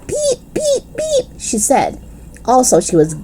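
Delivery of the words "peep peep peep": very high squeaky peeps; giggly peeping. Acted. Lucille keeps peeping to tease, squeaky. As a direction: peep like a giddy baby bird. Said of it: "peeping louder and louder".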